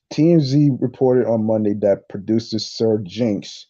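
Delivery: usual speaking voice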